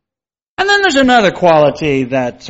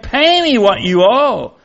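higher, slow and insistent demand; demanding servant; 6 semitones higher, 4 dB louder, slower than usual. Acted. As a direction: grab him and demand the money. Servant seizes debtor by throat.

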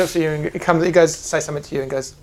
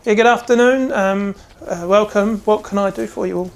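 put-on higher, eager young shop boy; young apprentice voice; 6 semitones higher, 5 dB louder than usual. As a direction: greet customers like a nervous lad. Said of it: "this young man goes".

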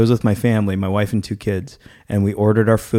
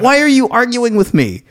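unload on the staff, pitched up; retold angry rant; 12 semitones higher than usual. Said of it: "I got so mad".

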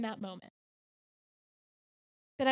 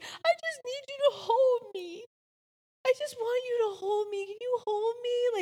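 put-on higher, tearful child's plea; imitating crying son; 9 semitones higher than usual. Mom voices her crying son asking to be held, tearful. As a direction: beg to be held like an upset little boy. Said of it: "he's just crying".

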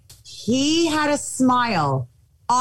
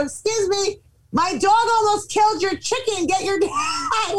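put-on calling to kids; 9 semitones higher than usual. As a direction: call out to the kids, breaking into laughter. Calling over fence to kids.

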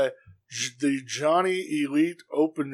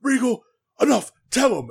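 higher, louder quoted plea; mock Kingston; 10 semitones higher, 4 dB louder than usual. Podcaster voicing Kingston urging Regal on, worked up. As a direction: hand it off like a fired-up wrestler.

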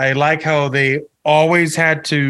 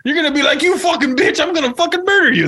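high, laughing mock rant; imitating Joe Pesci; 13 semitones higher than usual. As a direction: pitch it up and laugh through the argument. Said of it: "And he's like".

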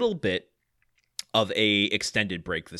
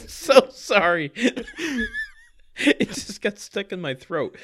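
laughing apology; 8 semitones higher than usual. Real, not acted. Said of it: "[name] burping straight into the mic".

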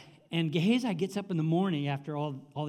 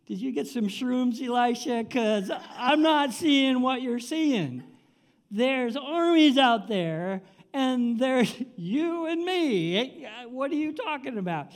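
high, slow, drawn-out disbelief; comic incredulity; 7 semitones higher, 5 dB louder, slower than usual. Acted. Servant doubting Elisha's unseen army, comic incredulity. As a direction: question him as if he's hallucinating.

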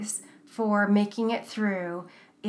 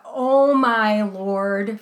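slow, drawn-out Gordon Ramsay gasp, louder; Gordon Ramsay exclamation; 8 dB louder, much slower than usual. Retold or imitated. Quoting Gordon Ramsay's shocked reaction, drawn out slowly. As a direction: stretch out Gordon Ramsay's dismay. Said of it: "from Gordon Ramsay".

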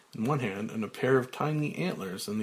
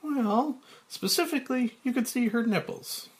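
high prim woman's voice, read as the censor; the censor objecting, voiced high and primly; lady censor; 13 semitones higher than usual. Censor answering Frank, in a high woman's voice. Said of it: "Lady Censor, to Frank".

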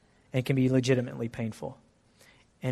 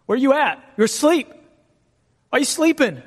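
much higher and louder accusing outcry; accusing cry; 10 semitones higher, 5 dB louder than usual. Retold or imitated. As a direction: hurl the questions at a sleeping God. Voicing a believer accusing God.

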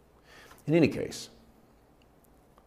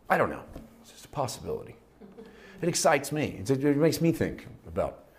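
usual speaking voice

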